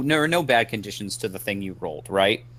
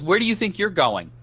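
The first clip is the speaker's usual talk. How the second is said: stern cop; 8 semitones higher than usual. Acted. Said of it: "she goes".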